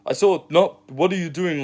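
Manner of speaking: high-pitched imitation of a Moroccan accent. Moroccan surfer challenging him in the water, imitated Moroccan accent. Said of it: "in a Moroccan tone and in his accent".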